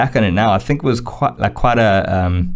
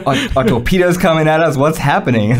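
high, startled worried questions; mock panic at torpedoes; panicked thought; 7 semitones higher than usual.

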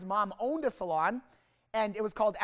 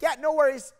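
higher and louder, faking calm; forced cheerful; 6 semitones higher, 7 dB louder than usual. Retold or imitated. Nervous student pretending he's fine, forced cheer. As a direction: bluff it out brightly. Nervous fake confidence reply.

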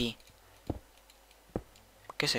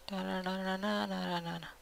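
teasing singsong; 6 semitones higher, 10 dB quieter, slower than usual. Pretend. Taunting singsong after insult.

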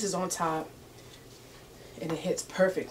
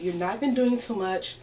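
ordinary talk, nothing marked

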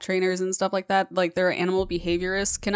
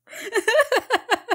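shaky laugh; slower than usual. Real, not acted. Shaken after scream, laughing.

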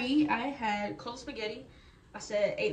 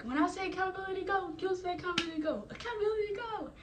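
fast, high mock instructor call building to a shrill cry; imitating a drill instructor, much higher; 10 semitones higher than usual. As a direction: call and response like a drill sergeant. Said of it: "he's gonna be like".

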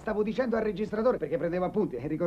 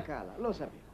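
"lo sapevo" low and soft; muttered realisation; 6 semitones lower, 8 dB quieter than usual. Acted. Friend hearing she is beautiful, muttered realisation. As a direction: drop "lo sapevo" under your breath. First, low take of repeated line.

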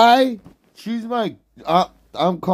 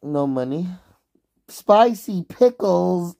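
usual speaking voice

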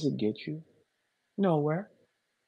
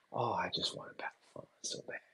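mocking whisper; 9 semitones lower, 15 dB quieter, faster than usual. Mocking imagined eager rival.

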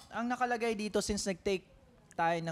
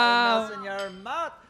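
louder, playful repeat; excited echo; 6 dB louder than usual. Real, not acted. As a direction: repeat it with relish. Echoing friend, louder.

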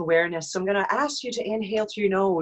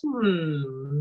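gentle hum demo; 5 semitones lower than usual. Shown as a demonstration. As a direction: hum lightly, lips closed. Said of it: "we just make some humming noises like this".

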